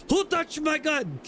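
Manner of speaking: imitating the Heavy. Co-host riffing on the game gunner, high slow mock voice. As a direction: stretch it out high, a silly gunner impression. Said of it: "the heavy gunner".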